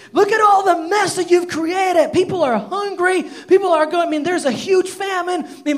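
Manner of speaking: furious tirade. Retold or imitated. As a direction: tear into him for the ruin. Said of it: "Ahab blames Elijah".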